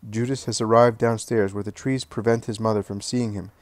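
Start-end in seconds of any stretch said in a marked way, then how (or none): none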